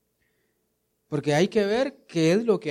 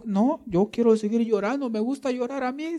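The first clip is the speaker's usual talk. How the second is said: mock whiny mourner; 8 semitones higher than usual. Mocking a brother who won't be consoled.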